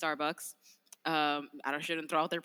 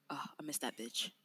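real whispered aside; 4 semitones lower, 7 dB quieter than usual. Real, not acted. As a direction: breathe it under your breath, fondly. Fond aside about lost dog.

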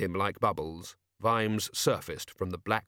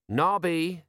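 higher, weary sergeant voice; sighed; 7 semitones higher than usual. A narrator's reading. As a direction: address a hopeless mate with resignation. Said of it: "sighed Colon".

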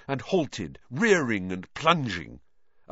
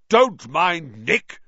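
slow, loud, much higher shout; 6 semitones higher, 8 dB louder, much slower than usual. Acted. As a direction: call out slowly and loudly. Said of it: "shouted Sir Patrick's head".

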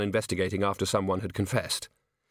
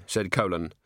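usual speaking voice

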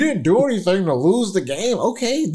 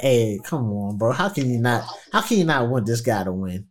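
low-voiced appeal; 8 semitones lower, 3 dB quieter than usual. Voicing himself rooting for Burrow.

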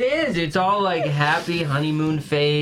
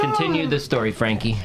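ordinary talk, nothing marked

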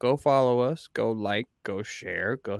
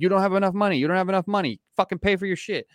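mock angry customer; 7 semitones higher, faster than usual. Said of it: "He's like".

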